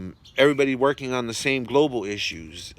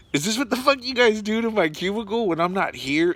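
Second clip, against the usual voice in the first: incredulous outrage; 9 semitones higher than usual. Retold or imitated. To coworkers who dried socks on his fan, outraged disbelief. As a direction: demand an answer, half laughing at the nerve.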